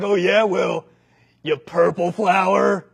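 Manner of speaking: loud, slow taunting bully; bully insult. Acted. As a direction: land the insult with a sneer.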